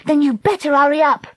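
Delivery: high, loud, urgent order; shouted. A narrator's reading. Dakota urging Treacle to row from sinking, shouting. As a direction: shout it to hurry a friend along. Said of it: "Dakota shouted".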